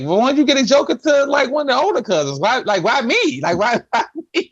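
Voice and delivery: put-on high, fast childish complaint; imitating his younger self, high and whiny; whiny kid voice. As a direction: whine like a wronged little kid. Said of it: "And I'm like".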